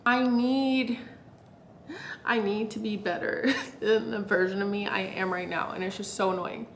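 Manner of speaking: soft, hushed complaining in a quiet room; quietly irritated. Real, not acted. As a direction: vent it under your breath. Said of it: "I'm actually so irritated".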